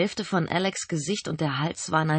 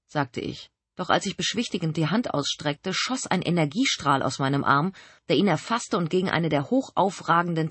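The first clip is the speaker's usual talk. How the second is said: usual speaking voice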